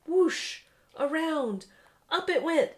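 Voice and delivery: high, loud whooshing build; excited launch. A narrator's reading. Narrator acting out the machine taking off, loud and excited. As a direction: sell the launch with rising excitement. Launch sound effects in story.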